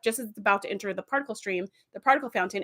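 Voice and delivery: normal talking voice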